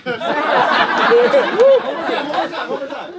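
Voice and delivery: high, loud laughing toast; excited cheer. Real, not acted. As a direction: shout it out in joy, way up high.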